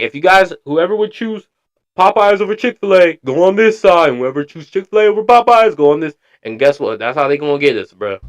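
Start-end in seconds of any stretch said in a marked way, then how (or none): 0.0-6.1 higher, louder mock announcer instructions; mock organizer voice. Streamer imitating the video hosts, mock bossy instructions. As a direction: ham up a bossy event host sorting people. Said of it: "They be like".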